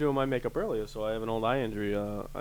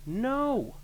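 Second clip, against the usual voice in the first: firm no; 12 semitones higher than usual. Retold or imitated.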